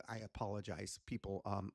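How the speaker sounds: ordinary delivery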